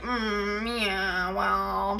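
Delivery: imitating a cold, dismissive mom; nasty tone. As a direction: sneer it flatly. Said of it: "not like".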